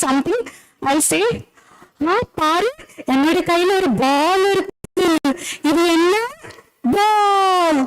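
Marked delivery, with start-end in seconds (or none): 2.1-7.8 lilting sing-song, fast, stressing ball; sing-song child talk. Shown as a demonstration. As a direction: coax a toddler with a bouncy sing-song tune. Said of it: "in a sing-song manner".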